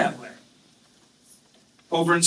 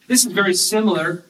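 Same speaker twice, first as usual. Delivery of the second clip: ordinary talk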